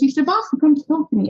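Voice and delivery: a hint of the eager mentor, rushed; imitating mentor.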